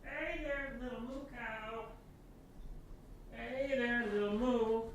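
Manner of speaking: high, hushed cooing; soft pet coo. Real, not acted. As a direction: coo gently to a small pet.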